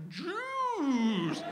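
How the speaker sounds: put-on long, high, stretched-out toddler wail; slow-motion toddler. Toddler falling toward the table, long slow-motion wail. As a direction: drag it out like a falling movie scene. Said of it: "everything goes in slow motion".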